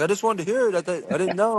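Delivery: high, sheepish dad excuse; innocent dad. Retold or imitated. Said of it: "He was like".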